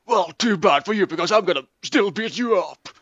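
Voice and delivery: high, cocky comic threat; hammed-up taunt. Rival to rival in mock fight, hammed-up taunt.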